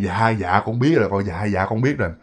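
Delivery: low, soft acted obedience; subdued and humble, voice dropped; meek submission. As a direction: give in quietly, as if kneeling before the master. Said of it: "lúc này mới quỳ mới lại".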